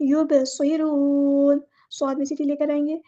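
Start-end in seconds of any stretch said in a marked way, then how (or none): 0.1-1.6 युबसिरून stretched very slowly, the बा bounced; correct slow recitation. Shown as a demonstration. Teacher models the correct word for students, slow recitation. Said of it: "युब नहीं".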